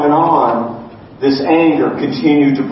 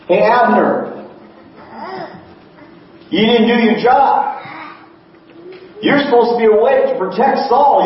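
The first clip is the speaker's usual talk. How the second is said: high, raised voice scolding from a distance; calling out; 8 semitones higher than usual. Acted. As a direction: shout the rebuke across the valley at the general. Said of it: "and David yells".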